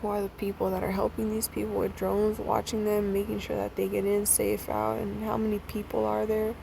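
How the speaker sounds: ordinary delivery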